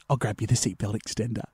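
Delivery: low, hushed aside; imitating a confidential murmur; discreet flight attendant. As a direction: lean in close and say it discreetly. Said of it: "gets down close".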